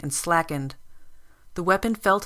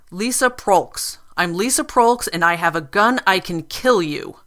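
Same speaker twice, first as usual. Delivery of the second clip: menacing; 2 semitones higher, 7 dB louder than usual. A narrator's reading. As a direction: state your name like a threat. Gunwoman names herself, threatens.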